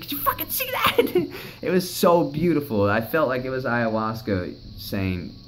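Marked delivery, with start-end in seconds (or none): 0.0-1.3 much higher, thrilled shout of disbelief; amazed exclamation. Retold or imitated. Asking Cassidy about the shooting star, squealing with amazement. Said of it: "I was like". 1.5-2.9 laughing. Real, not acted. Laughing after retelling the star.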